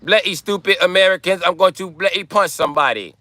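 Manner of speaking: high, hammed-up British accent; mock British accent. Shown as a demonstration. British guy threatening to punch somebody, mock British accent. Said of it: "I believe the British guy".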